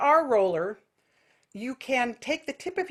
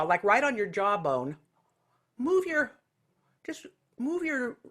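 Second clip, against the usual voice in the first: ordinary delivery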